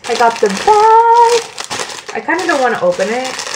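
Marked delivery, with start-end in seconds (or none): 0.1-1.6 high, loud, stretched-out squeal; gleeful squeal. Real, not acted. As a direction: squeal it with real glee, dragging the last word. Thrilled at the bag.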